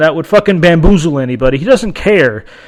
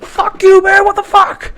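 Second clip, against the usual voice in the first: angry retort; 13 semitones higher than usual. Retold or imitated. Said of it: "And I'm like".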